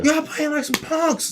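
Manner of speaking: high-pitched angry coach yell. Retold or imitated. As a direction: bark it like a furious coach. Coach cussing out kids.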